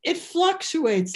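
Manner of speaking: ordinary delivery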